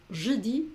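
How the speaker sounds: "je dis" ending on the vowel, no S sound; silent final S. Shown as a demonstration. Teacher repeats "je dis" for the learner, final S silent. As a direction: model "dis" as "dee". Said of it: "la S final no se pronuncia".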